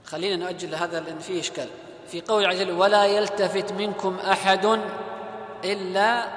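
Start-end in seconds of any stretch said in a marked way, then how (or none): 2.7-6.3 slow, drawn-out chanted recitation; Quranic recitation. Shown as a demonstration. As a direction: recite the verse slowly with tajweed. Teacher recites Quranic verse slowly. (تلاوة قرآنية بطيئة)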